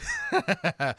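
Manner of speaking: squeaky high laugh burst; high giggle. Real, not acted. Host cracking up at his own bad accent, high giggle. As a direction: break up laughing.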